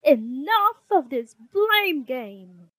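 fed-up mother laying down the law; angry mama's shout. Acted. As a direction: shout to stop the squabbling, drawn out. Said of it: "she shouted".

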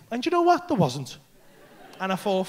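ordinary talk

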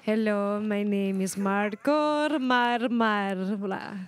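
very slow, drawn-out character introduction; mystery dignitary. Pretend. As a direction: stretch the name out slowly like a visiting dignitary. Improvised dignitary introduces herself, very slowly.

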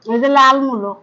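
chewing between words; talking around food; mouth partly full. Real, not acted. Seen 0: left woman bites radish before, mouth working while speaking.

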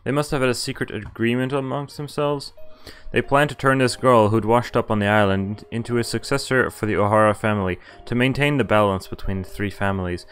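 usual speaking voice

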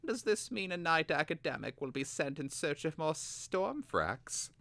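lightly voiced schemer playing innocent; innocent Vilnix. A narrator's reading. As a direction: sound harmlessly curious. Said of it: "he said innocently".

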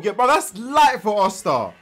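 slow, loud amazed outburst; excited. Real, not acted. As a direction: gasp out the disbelief. Excited reaction to training feat.

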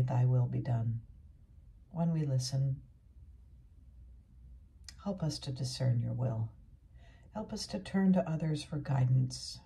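measured, earnest prayer voice; pastoral prayer. Real, not acted. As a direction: pray it out steadily for the congregation. Leads closing prayer.

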